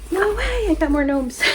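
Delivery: high, loud squeal of surprise; delighted surprise. Real, not acted. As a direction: gasp it out, thrilled at the gift.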